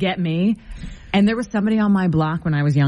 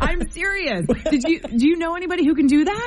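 laughing; 7 semitones higher than usual. Real, not acted. Both laughing about whistling.

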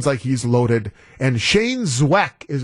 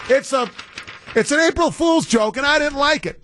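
high, whiny complaint; mock indignant; 10 semitones higher than usual. Real, not acted. Host whining that the April Fool's joke got him. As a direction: sulk about being fooled, voice up high. Admits being fooled, indignant.

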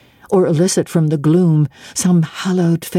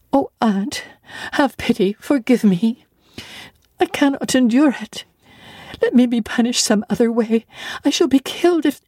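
put-on high, frantic begging child; desperate pleading; 6 semitones higher than usual. Terrified child begging her aunt for pity, high. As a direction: plead for mercy in terror.

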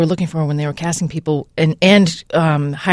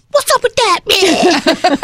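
high mock exclamation; 12 semitones higher, much slower than usual. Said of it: "and I was like".

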